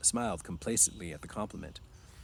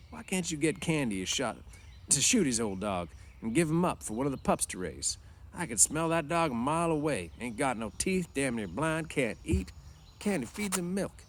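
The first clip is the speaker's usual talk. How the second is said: higher, blunt ranch-hand complaint; Carlson's voice; 7 semitones higher than usual. Acted. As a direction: push the idea, bluntly. Carlson argues about the dog.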